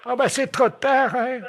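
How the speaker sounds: put-on slow, high-pitched old lady; slow squeaky elderly woman. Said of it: "passer le téléphone à la dame".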